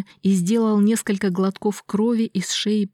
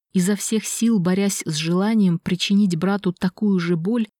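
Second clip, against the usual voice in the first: usual speaking voice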